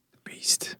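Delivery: breathy whisper, almost silent; spooky whisper. Acted. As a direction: breathe it like a ghost story. Spooky aside about the witch.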